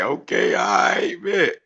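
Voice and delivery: high, loud hyped-up agreement; excited. Retold or imitated. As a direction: bounce it out like you're pumped. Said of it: "bouncing like".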